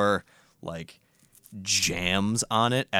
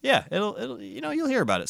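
high playful voice; faster than usual. Pretend. Teasing high voice.

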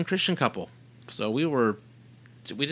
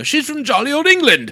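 put-on English accent; 13 semitones higher, 7 dB louder than usual. Joking about English listener.

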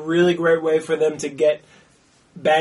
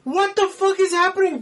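very high-pitched mock astonishment; squeaky, thrilled disbelief; excited shock; 14 semitones higher than usual. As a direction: replay your stunned delight, voice way up. Retelling shocked, happy movie reaction.

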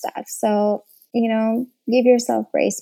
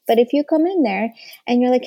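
usual speaking voice

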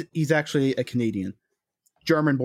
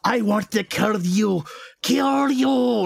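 slow, higher, hammed-up menace; mock villain; 6 semitones higher, much slower than usual. Podcast host mocks the film's Russian villain, hammed-up menace. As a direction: ham up a cartoon Russian villain's threat. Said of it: "He's just like".

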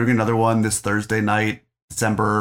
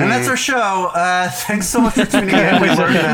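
mock announcer sign-off; 10 semitones higher, 5 dB louder than usual. Mock podcast sign-off, high.

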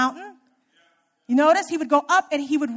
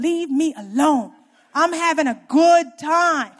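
emphatic, slowed plea; 3 semitones higher, 7 dB louder, slower than usual. Real, not acted. Emphatic plea mid sermon.

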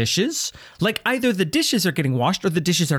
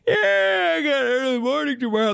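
fake excuse; 10 semitones higher than usual. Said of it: "you can't be like".

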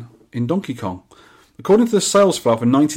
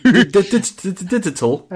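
stammering over digital, pitched up; mock stutter; 7 semitones higher, 3 dB louder than usual. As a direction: trip over the word, teasing. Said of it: "how hard it is for me to say the word digital".

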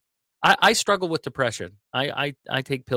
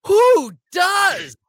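high, loud scornful yell; mock outraged shout; 8 dB louder than usual. Tough elder scoffing at a depressed youngster, high yell. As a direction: bellow it like a fed-up old farmer. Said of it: "It would be like".

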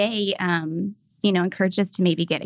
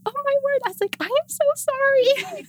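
mortified mom. Retold or imitated. Mom apologizing to the cashier for her son, mortified high voice. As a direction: squeak out the apology, horrified. Mom embarrassed by son's outburst.

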